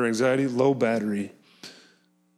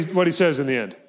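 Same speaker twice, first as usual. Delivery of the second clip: ordinary talk, nothing marked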